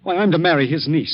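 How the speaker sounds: stunned, voice jumping up; shocked outburst. Acted. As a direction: blurt out the shock. Shocked by the accusation.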